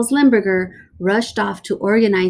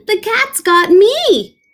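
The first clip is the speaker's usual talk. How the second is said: higher, louder cry; panicked Rosie; 12 semitones higher, 6 dB louder than usual. Acted.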